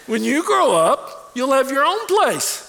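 high, loud startled protest; recoil, then slow down to explain; startled dad. Retold or imitated. Father caught off guard by his little son, loud and high.

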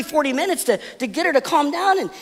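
ordinary talk, nothing marked

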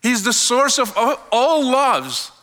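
usual speaking voice

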